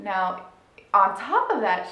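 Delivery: normal talking voice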